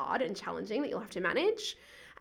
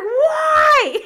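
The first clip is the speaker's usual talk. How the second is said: high, loud, half-laughing shout; boy shouting a demand at the teacher; mock yell; 15 dB louder than usual. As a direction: bark the question at her. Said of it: "he's like".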